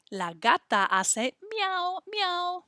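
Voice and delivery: slow, high squeaky miau; meowing kitty. Shown as a demonstration. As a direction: stretch the miau up high like a cat. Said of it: "what kind of sounds do they make".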